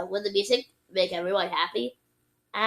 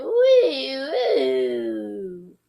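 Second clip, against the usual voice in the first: failed horse neigh, mooing; high wail sliding down like a sick cow; sick-cow whinny; 4 semitones higher than usual. A narrator's reading. Thomas trying a horse noise, sick-cow wail. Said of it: "Thomas sounded more like a sick cow".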